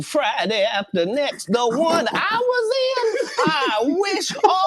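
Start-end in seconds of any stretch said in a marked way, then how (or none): 1.5-4.7 high, slow, near-shouted grievance; impassioned. Real, not acted. Actor accusing the comedian on camera, heated. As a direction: let the hurt break out.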